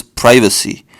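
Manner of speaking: privacy said PRY-vuh-see; American pronunciation. Shown as a demonstration. Teaching the US way of saying privacy, American pron. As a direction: say privacy with a long i. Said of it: "Americans call this".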